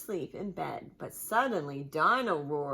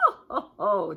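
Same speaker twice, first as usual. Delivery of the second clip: chuckle; 7 dB louder than usual. Acted. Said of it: "chuckles Daddy Pig".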